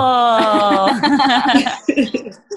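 bright loud laughter. Real, not acted. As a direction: burst out laughing. Laughing at the joke.

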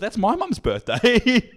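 high, drawn-out mock disbelief; retold incredulity. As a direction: protest slowly and high, imitating someone astonished. Retold shock at friend's false claim.

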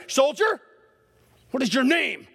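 high, stern general's demand; mock stern general; stern command. General demands name again.

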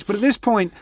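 usual speaking voice

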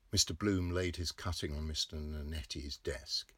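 plain reading voice

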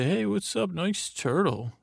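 high, slowed mock-cheery greeting; a hint of breezy twin brothers saying hi; lightly voiced twins. Twin brothers greet, acted quote.

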